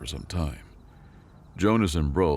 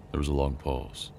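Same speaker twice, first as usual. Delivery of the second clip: usual speaking voice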